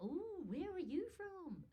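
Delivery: high, drawn-out swooping question; intrigued questioner. Retold or imitated. People in New Zealand asking her origin, mock intrigue. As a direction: imitate a cooing, curious stranger.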